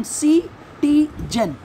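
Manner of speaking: "सिटीजन" slowly, syllable by syllable; three detached beats on "सिटीजन"; split into syllables. Shown as a demonstration. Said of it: "जैसे हम pronounce कर रहे हैं".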